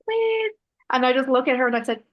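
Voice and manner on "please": much higher, louder pleading call; mock-helpless appeal for attention; pleading crowd call. Workshop leader begging an unheeding group to listen, high pleading call.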